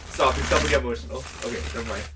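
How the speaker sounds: loud, flustered outburst of feeling; getting emotional. Real, not acted. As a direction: burst out loudly, cutting yourself off before you well up. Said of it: "I'm gonna get emotional".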